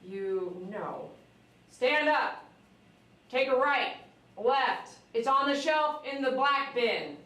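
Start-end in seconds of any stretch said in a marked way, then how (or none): 1.7-7.2 military command. Retold or imitated. Firm orders, imitating military.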